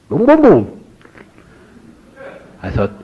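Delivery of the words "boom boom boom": high, loud "boom, boom, boom"; booming sound effect. Retold or imitated. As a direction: punch out each boom like an explosion. Life flashing past, sound effect.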